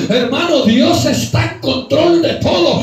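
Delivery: rising, loud pulpit preaching; fervent preaching. Acted. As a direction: preach it out with full force. Preaching to congregation.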